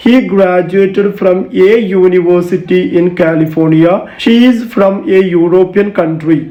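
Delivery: very slow, clear teaching read; slow example sentences. Shown as a demonstration. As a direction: read both sentences slowly for the class. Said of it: "For example". (പതുക്കെ വ്യക്തമായി)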